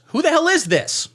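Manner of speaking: jumps up high, indignant; who is texting me, hammed-up; mock suspicious dealer. Dealer challenges the unknown texter, mock indignant. Dealer answering a strange text.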